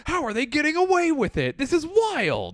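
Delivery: hammed-up outrage at the rivalry; shrill mock shock at the rivalry; mock disbelief. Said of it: "both of us are like".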